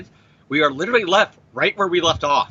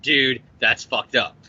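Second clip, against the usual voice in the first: slow repeated quote; 6 semitones lower, slower than usual. Retold or imitated. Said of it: "who told Doctor Octopus".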